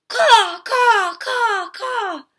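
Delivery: high squawking bird calls; puffin squawks. Acted. Startled puffins squawking as they try to fly. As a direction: caw like panicked seabirds.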